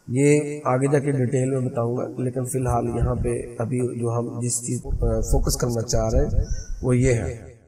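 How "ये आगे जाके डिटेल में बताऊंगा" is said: ordinary delivery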